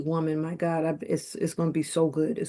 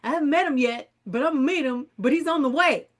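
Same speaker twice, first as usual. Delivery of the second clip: giddy customer; 8 semitones higher than usual. Retold or imitated. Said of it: "I said".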